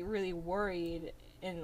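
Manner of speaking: normal talking voice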